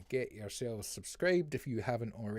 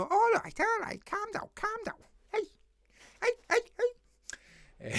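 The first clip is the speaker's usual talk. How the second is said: very high, exaggerated Scouse; hammed-up, high-pitched Scouse catchphrase; terrible Scouse accent; 4 dB louder than usual. Shown as a demonstration. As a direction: squeal it in a bad Liverpool accent. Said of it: "Scouse accent".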